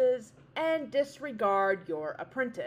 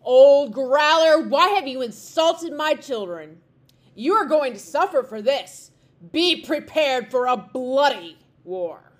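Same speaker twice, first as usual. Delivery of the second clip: calling into cave; 4 semitones higher, 12 dB louder than usual. A narrator's reading. Wren king calling into the bear's cave, loud challenge. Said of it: "called in".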